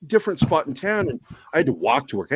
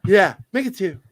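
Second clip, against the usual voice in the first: mock cheery customer; 6 semitones higher than usual. Cheery order to ex-boss.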